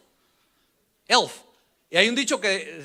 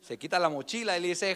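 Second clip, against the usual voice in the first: normal talking voice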